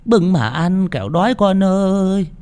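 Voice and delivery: put-on high, slow old mother's call; old woman calling her son, high and slow. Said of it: "Bà ấy lẩm bẩm".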